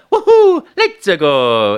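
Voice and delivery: high, loud joyful Mario cheer. Retold or imitated. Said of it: "face challenges with life with a".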